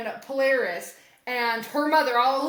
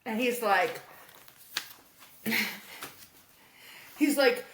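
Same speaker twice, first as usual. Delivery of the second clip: ordinary talk, nothing marked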